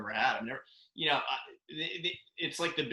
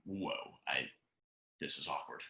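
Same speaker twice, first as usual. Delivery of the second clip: low, soft, lightly voiced uneasy assistant; imitating awkward auditor; 10 semitones lower, 9 dB quieter than usual. Imitating the uneasy young auditor, low and soft. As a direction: mumble it like an embarrassed onlooker. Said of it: "this auditor is just kinda like".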